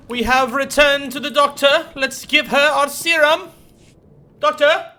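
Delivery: loud, high, cheerful announcement; excited calling out. Real, not acted. Gamer calling to the doctor on screen, loud and excited. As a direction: call out loud and high with glee.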